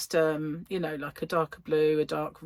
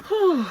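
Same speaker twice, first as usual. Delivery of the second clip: loud high whew of relief; relieved whew; 12 semitones higher, 9 dB louder than usual. Real, not acted. After finishing the jokes, a loud relieved whew. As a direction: blow out a big whew.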